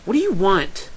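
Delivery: high, loud, irritated question; loud, hostile greeting; annoyed demand. Acted. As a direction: demand it crossly. Upset Lyra confronts the visitor.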